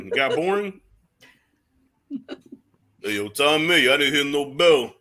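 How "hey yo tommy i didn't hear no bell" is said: punchy Stallone-style boxer voice; slurred movie-boxer impression; Rocky impression. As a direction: call out like the battered movie boxer, amused. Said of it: "That impression is awesome".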